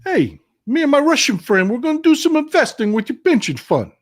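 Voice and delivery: high sly mobster voice; Wise Guy Jimmy. Retold or imitated. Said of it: "Yeah, Wise Guy Jimmy".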